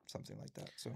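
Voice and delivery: whispered aside. Real, not acted. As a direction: drop to a whisper. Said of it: "aside after quote".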